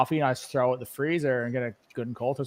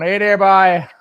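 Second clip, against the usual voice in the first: put-on Newfoundland accent; 4 semitones higher, 9 dB louder, slower than usual. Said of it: "if you heard some of them going".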